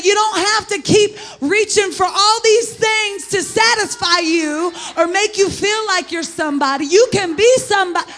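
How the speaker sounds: high, shouted exhortation to the congregation; full-force preaching. Real, not acted. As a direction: drive it home loudly, like a fired-up preacher.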